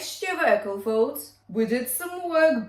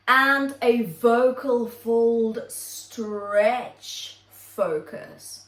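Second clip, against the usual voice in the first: drawn out; much slower than usual. Shown as a demonstration. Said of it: "announcing the exercise title".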